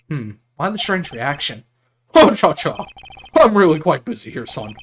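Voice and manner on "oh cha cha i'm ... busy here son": blustering big-man refusal, emphatic swoops; pompous lawyer, flustered. Acted. Lawyer too busy for the case, blustering pompously. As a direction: brush them off with grand bluster.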